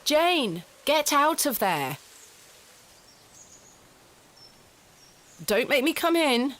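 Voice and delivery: calling out. Acted. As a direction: call her out of hiding. Calling child out of hole.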